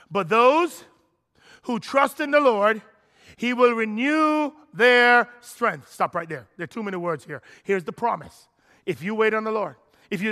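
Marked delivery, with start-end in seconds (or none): 0.1-5.9 loud, slow, proclaiming the verse; fervent scripture reading. Acted. Preacher proclaiming Isaiah's verse aloud, slow and loud. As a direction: proclaim the promise slowly.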